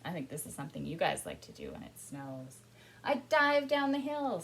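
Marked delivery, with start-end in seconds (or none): 3.0-4.4 loud, high, gleeful story otter; otter character. A narrator's reading. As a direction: call it out as an otter diving downhill. Says the otter.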